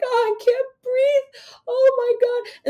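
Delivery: high, slow wailing mock sobs; mock crying tantrum. Daughter mocking mother's couch tantrum, slow high mock wail. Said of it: "throwing themselves on the couch".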